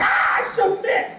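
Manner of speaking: high strangled yell of pain; pained surrender. Retold or imitated. Comic giving in to a crushing handshake, pained shriek. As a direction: yelp it out as your hand gets crushed.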